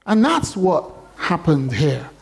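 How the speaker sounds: usual speaking voice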